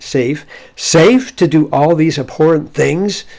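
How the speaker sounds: loud, slow incredulous echo; indignant disbelief, drawn out and emphatic; dumbfounded exclamation. Acted. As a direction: repeat it as half exclamation, half question. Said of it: "sort of an exclamatory, you could almost put a question mark".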